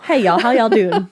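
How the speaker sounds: hammed-up Southern greeting; playful Southern accent. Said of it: "southern accent".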